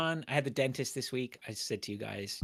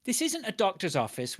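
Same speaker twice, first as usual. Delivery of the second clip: much higher, heated mock outburst; ranting at dentist; 11 semitones higher than usual. Complaining about the dentist to friends, heated rant. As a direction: vent it like you're telling them off. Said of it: "I'm like".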